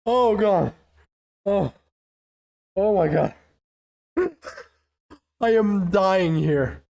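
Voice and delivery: ordinary talk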